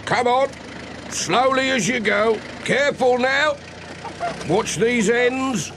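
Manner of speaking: high, coaxing traffic calls; calling directions. Acted. Policeman in the road, guiding vans past, coaxing high calls. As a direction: wave the traffic through, calling out.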